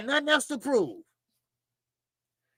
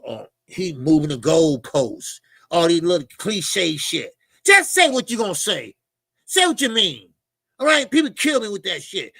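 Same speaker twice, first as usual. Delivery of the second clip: ordinary delivery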